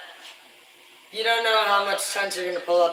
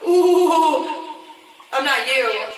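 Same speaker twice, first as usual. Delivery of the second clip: high, louder teasing sing-song with a laugh; mocking retort; 7 semitones higher, 9 dB louder, much slower than usual. Answering crew who called him turd, high teasing laugh. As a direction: tease them back with a laughing taunt.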